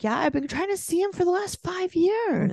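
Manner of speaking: high mocking impression of the ex; mock Andrew voice. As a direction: whine it like a clueless dad. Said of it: "Andrew's like".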